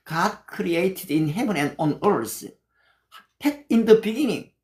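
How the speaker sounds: slow, deliberate English verse recital; measured English Bible reading; scripture recitation. Shown as a demonstration. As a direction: recite each word slowly like memorized scripture.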